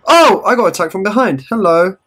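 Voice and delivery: high, loud startled cry; alarm shout. Real, not acted. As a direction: yelp at a sudden attack. Attacked from behind in game.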